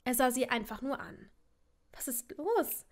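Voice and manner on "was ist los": higher, tentative rising question; mildly hesitant query; unsure. A narrator's reading. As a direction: ask uncertainly, voice lifting. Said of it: "fragte sie unsicher".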